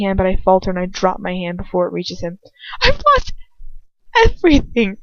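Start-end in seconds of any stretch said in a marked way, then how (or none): 2.8-5.0 high, loud, trembling plea; a girl's voice close to tears. A narrator's reading. Girl confronting her lover, high and trembling.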